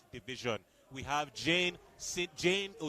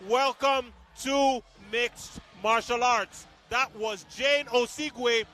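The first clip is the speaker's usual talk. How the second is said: higher, louder hyped play-by-play; excited commentary; 7 semitones higher, 7 dB louder than usual. Real, not acted. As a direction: celebrate the knockout on air.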